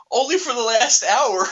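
tearful reply. Retold or imitated. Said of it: "She's like".

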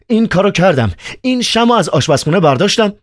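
Ivan's voice. Acted. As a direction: play Ivan, high and worked up. Narrator voices Ivan.

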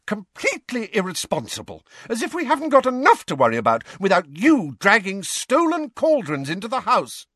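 high-pitched, scolding tirade; shouting mum. A narrator's reading. Mum scolding over stolen cauldrons, shouting. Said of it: "hearing Mum shouting at someone else".

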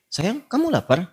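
high, lilting innocent question; innocent asking. Acted. Wife asks her new husband about food, innocently. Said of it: "polos kayak nanya".

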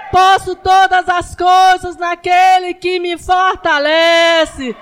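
loud, slow declaring; thundering sermon; preaching at full force. Acted. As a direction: proclaim each line like a battle cry.

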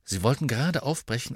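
usual speaking voice

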